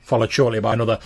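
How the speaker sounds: ordinary delivery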